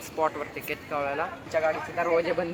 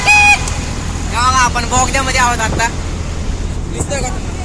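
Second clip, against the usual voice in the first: loud, high calls to hear the echo; shouting in the tunnel; 10 semitones higher, 11 dB louder than usual. Real, not acted. Vlogger shouting to viewers inside a tunnel, loud and high. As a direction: yell it out so it booms off the tunnel walls.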